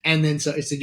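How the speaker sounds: usual speaking voice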